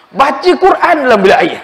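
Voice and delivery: high, loud scolding; hammed-up angry reproach; mock angry father. Father angry at son singing.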